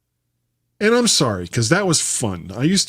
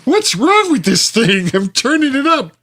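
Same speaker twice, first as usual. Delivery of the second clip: high, hammed-up complaining voice; mock exasperation; 12 semitones higher, 4 dB louder than usual. Host imagines the wife fighting the volume, mock exasperation.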